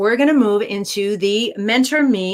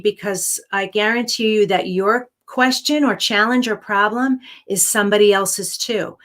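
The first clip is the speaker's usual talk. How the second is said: usual speaking voice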